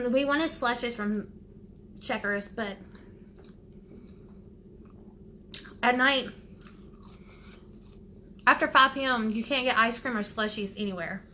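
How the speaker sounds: talking around a mouthful; muffled by food in mouth; mouth full, chewing. Real, not acted. Seen 0: Eats from foil wrapper, puts fry in mouth, chews between words.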